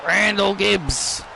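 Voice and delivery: drawn-out arena announcement; slow build-up for the entrance; slow announcer intro. Acted. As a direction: ring announcer calling the wrestler out slowly.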